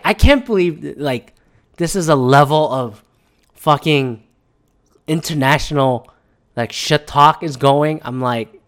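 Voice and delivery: ordinary delivery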